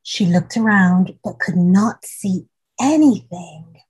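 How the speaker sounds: ordinary delivery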